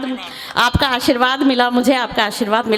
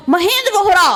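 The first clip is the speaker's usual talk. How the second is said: louder call; 5 semitones higher, 4 dB louder than usual. Retold or imitated. Poet calls Vohra's name again, loud. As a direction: shout the name to the hill. Calling out to the hill.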